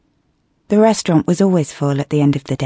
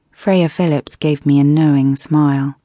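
ordinary delivery

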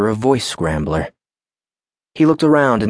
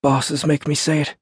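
higher, wry guard voice; a lightly voiced joking guard; Baron's voice; 7 semitones higher than usual. A narrator's reading.

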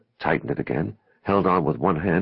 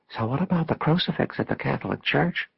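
higher-pitched questioning woman; a hint of a curious parishioner, pitched up; lightly voiced churchwoman; 8 semitones higher than usual. A narrator's reading. Character asks, gossiping among churchwomen.

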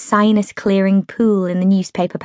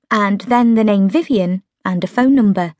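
ordinary delivery

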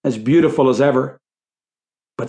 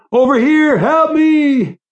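high, loud scream for help; panicked high-pitched yell; desperate shouting; 13 semitones higher, 4 dB louder, slower than usual. A narrator's reading. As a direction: shout frantically to be found. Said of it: "He could still hear the shouting".

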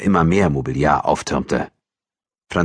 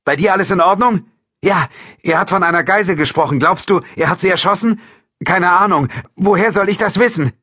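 high, raised, urgent voice; rattled and loud; agitated exchange; 5 dB louder than usual. A narrator's reading. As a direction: talk frantically over the radio. Frantic radio exchange after a gunshot.

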